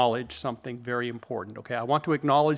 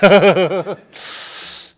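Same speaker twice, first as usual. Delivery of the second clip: big real laugh at his own joke; hearty loud laugh; 5 semitones higher, 11 dB louder than usual. Real, not acted. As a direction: burst out laughing, loud and high.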